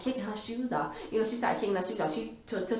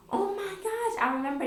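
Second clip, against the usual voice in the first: high, breathless thrill of recognition; excited gasp. Retold or imitated. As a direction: gasp and squeal it out.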